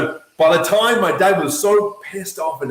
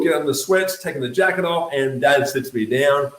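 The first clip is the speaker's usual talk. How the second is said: ordinary talk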